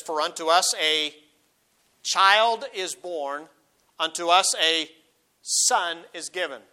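slow, swelling scripture read; solemn pulpit reading. Acted. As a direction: proclaim it slowly, leaning on child. Preacher reads Isaiah with emphasis.

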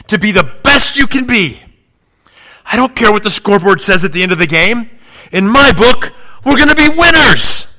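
building from steady to a loud, high rallying cry; inspiring pregame speech. Retold or imitated. Coach firing up his team before the game, building to a shout. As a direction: climb until the last line is a shout. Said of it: "gives an inspiring pregame speech".

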